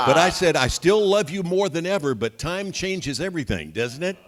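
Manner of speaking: retold joke, laughing through it; teasing through laughter. Husband teasing his wife at dinner, laughing. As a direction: chuckle as you land the jab.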